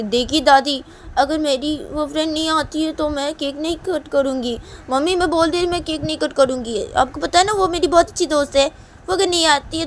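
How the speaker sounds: put-on high, loud kid's ultimatum; insistent child voice. Child threatens to refuse cutting cake.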